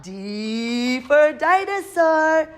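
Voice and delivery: high, slow sing-song D for dinosaur; sing-song announcement. Shown as a demonstration. Teacher to the class, slow high sing-song.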